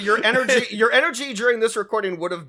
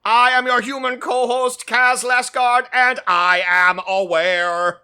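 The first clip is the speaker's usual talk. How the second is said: slow, stiff robot voice; old speech synthesizer; 5 semitones higher, 3 dB louder than usual. Acted. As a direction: speak like a clunky space robot. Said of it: "This is canonical ... I'm a robot from space".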